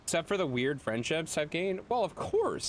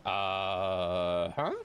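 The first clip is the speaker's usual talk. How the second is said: confused mock reaction; 6 semitones higher than usual. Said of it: "and I'm like".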